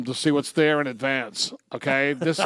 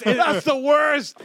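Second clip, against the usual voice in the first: much higher, slow squeal of disgust; high excited groan; 11 semitones higher, 4 dB louder, much slower than usual. Real, not acted. Host reacts to turkey fart joke with slow high squeal.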